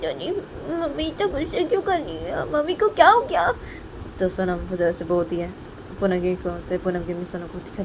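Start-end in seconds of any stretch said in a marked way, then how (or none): 0.0-3.5 put-on high, small questioning kid voice; little child voice. Sonam asks grandmother why mother is angry, childlike.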